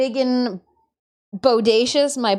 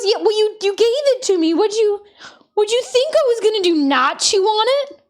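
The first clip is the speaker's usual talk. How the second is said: higher, louder indignant pet voice; pet's voice; 9 semitones higher, 4 dB louder than usual. Retold or imitated. Turtle arguing back at his owner, high indignant voice. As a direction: voice the turtle defending himself. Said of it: "He goes".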